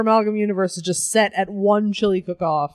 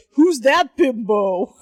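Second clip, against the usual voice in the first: put-on slow, louder, snotty kid voice; bratty kid; 3 dB louder, much slower than usual. A kid sneering at the new woman, slow snotty voice. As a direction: sneer it like a brat. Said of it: "one of these kids are like".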